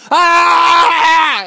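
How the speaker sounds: high loud scream of fear; crowd screaming. Acted. Fleeing townsfolk scream. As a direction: shriek in panic. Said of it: "They were just like".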